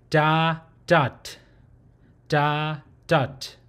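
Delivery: "da" open, "dot" closed with a sharp t; da dot demo. Shown as a demonstration. Teacher summing up the consonant with "da, dot". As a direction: say "da, dot" twice, spaced out. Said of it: "that's how you'll pronounce the".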